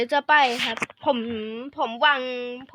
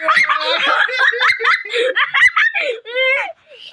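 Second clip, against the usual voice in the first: high, loud burst of laughter; long, helpless high-pitched cackle; big laugh; 10 semitones higher, 5 dB louder than usual. Real, not acted. Player cracks up at a mishap in the game, laughing hard.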